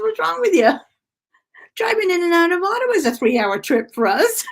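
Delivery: high, loud imitation of an incredulous dad; mock disbelieving dad. Dad baffled that she'd skip the hotel, mock disbelief. Voicing her dad's disbelief.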